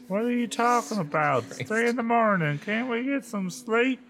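high, drawling, grumpy sister; Lindy, sleepy. A narrator's reading. Sister wanting sleep at three, high grumpy voice. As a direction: complain in a high put-on voice. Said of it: "Lindy asked sleepily".

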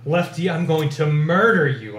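restrained narrated shout; angry shout. A narrator's reading. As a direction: shout the threat, pitch climbing. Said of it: "I shouted".

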